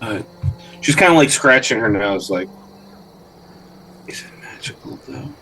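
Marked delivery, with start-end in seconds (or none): 4.1-5.3 hushed, secret whisper. Acted. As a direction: whisper the question close. NPC quietly asks about magic.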